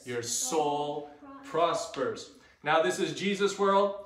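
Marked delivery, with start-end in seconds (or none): 0.0-2.3 slow, measured group recitation; verse with actions. Shown as a demonstration. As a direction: lead it phrase by phrase for followers. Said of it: "Here we go".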